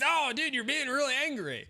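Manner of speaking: high, exaggerated quote of others; a hint of mocking chat viewers; mock accusation. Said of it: "to them, what I'm doing right now is".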